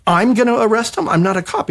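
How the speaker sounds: high, rising panic; alarmed disbelief. Retold or imitated. Writer told to make the arrest, high alarm. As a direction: squeak it like it's absurd.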